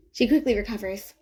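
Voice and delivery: plain reading voice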